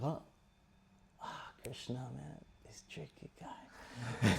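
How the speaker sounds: hushed, slow acted aside; rueful realisation. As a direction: grumble softly to oneself on being outwitted. Duryodhana realises he was tricked.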